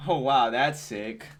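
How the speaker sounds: slow, understated imitation of excitement; mock enthusiasm. Gamer mimicking the trailer's presenter, slow understated mock enthusiasm. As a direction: drag out the wow with only mild mock excitement. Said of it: "She sounded so enthusiastic about it".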